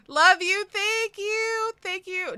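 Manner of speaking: high, gasping, emotional thanks; overwhelmed, high-pitched gushing; teary gratitude. Real, not acted. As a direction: squeal thanks through happy tears. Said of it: "I have tears in my eyes".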